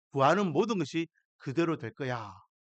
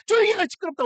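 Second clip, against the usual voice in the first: loud, much higher sharp rebuke; crowd hushing; 6 dB louder, much faster than usual. Retold or imitated. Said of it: "사람들이". (소경들에게 조용히 하라고 높고 크게 꾸짖는 군중)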